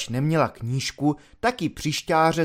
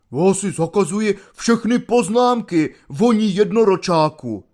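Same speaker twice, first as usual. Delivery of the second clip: loud, higher indignant shout; angry outburst; 5 semitones higher, 5 dB louder than usual. Acted. Flushed corporal forbids the volunteer's remarks, loud and higher. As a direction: leap up red-faced and shout him down.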